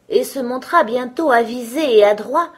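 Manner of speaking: ordinary delivery, nothing marked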